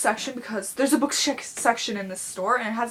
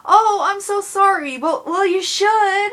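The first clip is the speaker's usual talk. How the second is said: high, loud, slow, whiny mock apology; mocking the customer; 9 semitones higher, 10 dB louder, slower than usual. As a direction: parody a flustered lady gushing sorry. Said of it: "And she's like".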